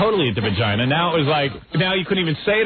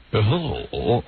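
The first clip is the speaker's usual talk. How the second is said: deep announcer voice; 3 semitones lower than usual. Retold or imitated. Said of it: "that deep voice guy".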